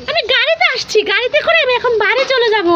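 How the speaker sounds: put-on high, cheeky little boy voice; child voice. Little son teasing his mother, in a high cheeky child voice. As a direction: play the teasing toddler answering mummy. Voicing son with toy car.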